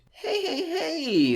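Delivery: high, mocking hey hey; sly high greeting. Intruder slyly chiding the comic, high and mocking. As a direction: tease him, lilting up high.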